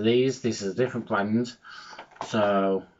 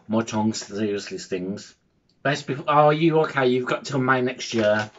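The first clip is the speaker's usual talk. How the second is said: normal talking voice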